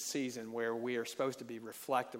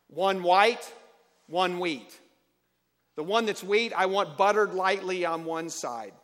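demanding husband; 5 semitones higher, 10 dB louder than usual. Acted. Grumpy husband giving demands.

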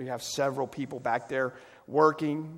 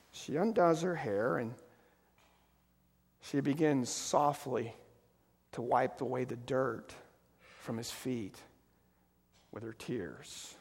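tender hush; 3 semitones lower, 6 dB quieter than usual. Real, not acted. Pastor describing her wiping his feet, tender and hushed. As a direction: let it sink to a hush.

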